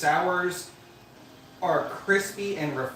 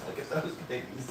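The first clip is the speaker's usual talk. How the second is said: giggling mock viewer line; laughing through words; 4 semitones lower, 9 dB quieter than usual. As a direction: laugh while saying it. Viewers voiced, laughing at feet.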